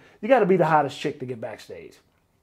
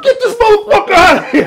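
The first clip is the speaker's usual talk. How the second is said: high, very loud acted shout; outraged yell; 12 dB louder than usual. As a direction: yell it like ordering security to eject someone. Retelling [name]'s outraged order.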